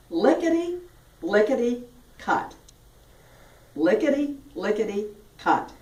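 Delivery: "Lickety, lickety, cut" in separate, crisply articulated beats; tongue-loosening drill. Shown as a demonstration. Teacher demonstrating a tongue loosener, "Lickety, lickety, cut" in separate beats. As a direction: lift each "Lickety" high and drop on "cut". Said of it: "One that really loosens the tongue".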